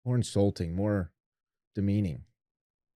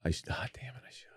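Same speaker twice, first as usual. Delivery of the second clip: whispered self-rebuke; whispering; 3 semitones lower, 10 dB quieter than usual. Real, not acted. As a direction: hiss it under your breath. Mock regret, whispered.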